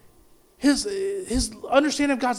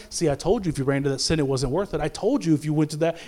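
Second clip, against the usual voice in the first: lower, quicker smug scolding; smug I told you so; 8 semitones lower, 4 dB quieter, faster than usual. Retold or imitated. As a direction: lecture them like a know-it-all.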